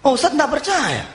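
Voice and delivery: high-pitched, indignant challenge; mock angry. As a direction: imitate a man taking offence. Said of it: "Marah dia".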